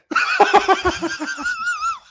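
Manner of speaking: high squealing laugh; helpless laughter. Real, not acted. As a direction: lose it completely, laughing high. Teller breaks down at own punchline.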